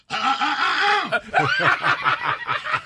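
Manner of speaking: high, slow, breaking stammer while laughing; frozen in fright, laughing through it; mock terrified stammer. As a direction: ham up a panicked guy lost for words. Imitating a scared man stammering.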